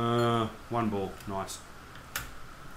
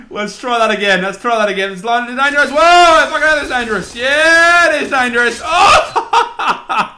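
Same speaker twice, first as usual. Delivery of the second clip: loud high frantic shouts; half laughing, half panicked; screaming through a hectic level; excited yelling; 6 semitones higher, 9 dB louder than usual. Real, not acted. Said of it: "I'm screaming so much".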